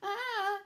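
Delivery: sharp high, loud cry of alarm; shocked cry. Acted. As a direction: yelp at the rubble. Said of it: "cried Square".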